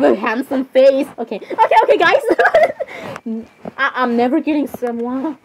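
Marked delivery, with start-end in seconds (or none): 2.3-3.4 put-on high girly giggle. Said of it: "you keep laughing like that".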